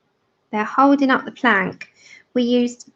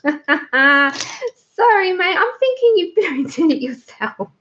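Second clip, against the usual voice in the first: giggling through the sorry; laughing apology; 5 semitones higher, slower than usual. Real, not acted. Laughing as she apologises to the viewer for misreading. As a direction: apologise while still laughing.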